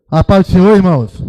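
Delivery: loud, high call to the congregation; rousing greeting. Real, not acted. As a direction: call out the greeting to get a stronger response. Repeats greeting to rouse a weak congregation.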